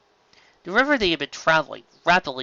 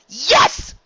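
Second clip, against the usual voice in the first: high loud joyful yes; much higher, louder triumphant yes; excited yes; 14 semitones higher, 7 dB louder than usual. Acted. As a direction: burst out with joy. Spike cheering.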